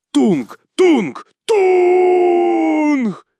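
loud, high hooted stomps, rising; heavy "toong" footfalls, final one held long; stomping noise. A narrator's reading. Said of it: "тупаючи".

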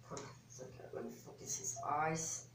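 ordinary delivery

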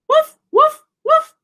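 high yappy woof; high, loud puppy barks; dog barking. Acted. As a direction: bark it out like a small dog.

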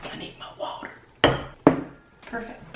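soft unvoiced whisper. Real, not acted. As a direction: keep it breathy and hushed. Quiet while child nearby.

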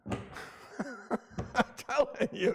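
breathy laugh through the words, soft and slow; chuckling at himself mid-sermon; laughing it off, barely voiced. Real, not acted. Laughs at his own preaching.

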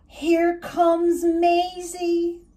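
gasping in awe. A narrator's reading. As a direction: gasp then say it in awe. Gasps at Maisie emerging.

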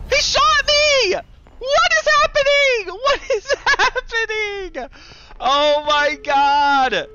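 very high, drawn-out shrieks; gasp, then scream slowly in horror; panicked screaming. Real, not acted. Player just got shot in the game, panicked screaming.

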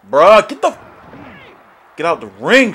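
loud, frustrated outburst; exasperated shout. Real, not acted. Gamer ordering a wrestler out of the ring, exasperated shout. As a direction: shout it at them, fed up.

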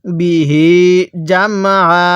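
measured chant with the last "hee" of বিহি held; slow Quran recitation. Shown as a demonstration. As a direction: recite slowly, stretching the end of বিহি. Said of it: "আমার সাথে পড়ুন".

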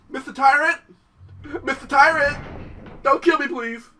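hammed-up fear; mock begging. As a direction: beg the tyrant for mercy. Begging the tyrant.

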